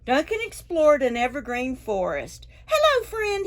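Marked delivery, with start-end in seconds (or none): 2.7-3.4 bright, much higher cheerful hello; friendly dragon greeting. A narrator's reading. Dragon greeting the raccoon, high and cheerful. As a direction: greet a new friend sweetly.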